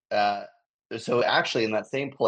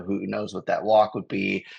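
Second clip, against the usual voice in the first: normal talking voice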